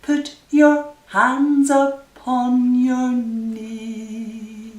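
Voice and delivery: normal talking voice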